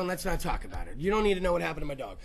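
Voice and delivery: low, soft avoidance; guilty evasive. Retold or imitated. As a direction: mumble it to dodge the question. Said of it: "I sit down quick".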